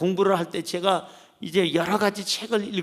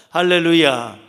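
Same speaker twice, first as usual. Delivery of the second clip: shouted hallelujah; a rousing cry to the congregation; loud hallelujah; 3 semitones lower, 9 dB louder than usual. Real, not acted. 회중을 향해 크게 외치는 할렐루야.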